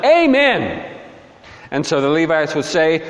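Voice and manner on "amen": mock group yell. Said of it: "the people will yell back".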